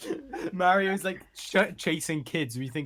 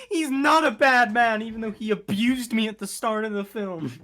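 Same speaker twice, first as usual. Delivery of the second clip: mock Disney princess; 8 semitones higher, 9 dB louder than usual. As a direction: gush like a naive Disney princess. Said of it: "and then she's like".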